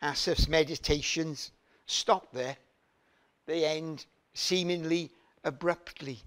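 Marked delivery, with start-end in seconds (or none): none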